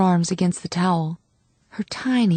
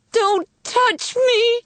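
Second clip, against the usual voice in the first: high, slow, pained shout; shrieking at her sister to back off; 3 dB louder, much slower than usual. A narrator's reading. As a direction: scream it out in labour pain. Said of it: "she shouted".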